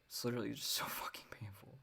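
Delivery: whispered, breaking into a laugh; exasperated whisper. Real, not acted. Podcaster tired of reading Hegel critique, whispering with a laugh. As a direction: hush it out, half laughing at the pain of it.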